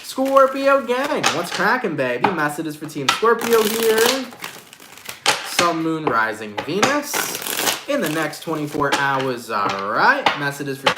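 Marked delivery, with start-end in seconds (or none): none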